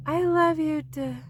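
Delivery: high, then fading off mid-word; tired cub trailing off before bedtime; sleepy, unfinished. Acted. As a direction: start the words and drift away tired. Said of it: "he didn't finish".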